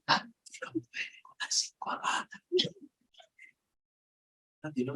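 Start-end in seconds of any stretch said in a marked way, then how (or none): 0.1-2.4 slow whispered echo of the rule; repeating the pastor's words in a hush. Retold or imitated. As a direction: whisper it back in disbelief. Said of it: "le digo".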